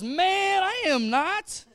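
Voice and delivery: high, slow, laughing brush-off; laid-back Peter laughing off the accusation; casual dude Peter. Acted. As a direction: shrug it off with a grin. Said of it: "You ever read the Bible like that?".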